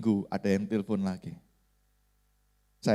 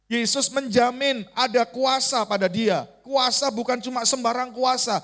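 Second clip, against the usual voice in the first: higher, forceful proclamation; emphatic preaching; 9 semitones higher, 4 dB louder than usual. Real, not acted. Preacher proclaims Jesus's power to congregation, emphatic and high.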